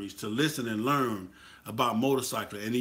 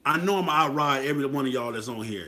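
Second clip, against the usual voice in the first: ordinary talk, nothing marked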